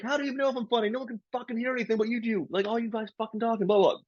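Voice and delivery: high, fast, exasperated rant; retold rant. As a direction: fire it off in a high-pitched tirade. Comic ranting at hecklers.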